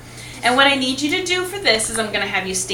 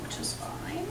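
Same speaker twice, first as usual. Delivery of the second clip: whispered aside; 4 semitones higher, 15 dB quieter, slower than usual. Real, not acted. Aside while recording the result.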